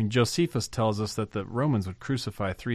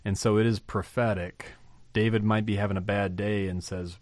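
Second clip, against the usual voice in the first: ordinary talk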